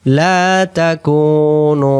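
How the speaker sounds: slow melodic chant; Quran recitation. Acted. Teacher reciting the verse for the class. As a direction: draw the phrase out like tilawat.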